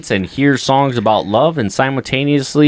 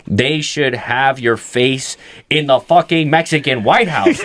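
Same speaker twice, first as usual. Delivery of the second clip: mock flattery; 7 semitones lower than usual. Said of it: "I was like".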